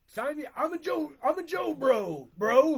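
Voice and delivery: loud, high fanboy outburst; hyped-up fan. Pretend. Dad declaring himself a Jonas Brothers fan, hyped-up. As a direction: build to a shout on the last word.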